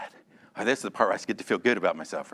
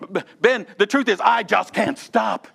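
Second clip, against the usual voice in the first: a raised, pleading voice for a man who can't stop; mock confession; 8 semitones higher, 5 dB louder than usual. Preacher acting a man confessing his anger, pleading. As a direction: voice the angry man begging Ben for help.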